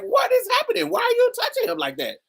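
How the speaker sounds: mother demanding answers, high and fast; alarmed mother. Retold or imitated. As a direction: play the panicked mother. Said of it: "my mother was like".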